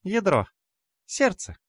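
очень высокий громкий голосок; выкрикни тонко, как малышка; детский голос демонёнка. A narrator's reading. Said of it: "произнесла демонёнок". (пронзительный детский возглас)

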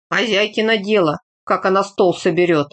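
ordinary delivery

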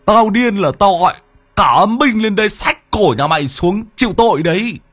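high, put-on voice, chewing while boasting; shaman boasting to the ghost while munching; mouth-full boast. A narrator's reading. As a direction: brag through a mouthful of apple. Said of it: "nhồm nhoàm nói".

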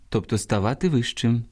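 ordinary talk

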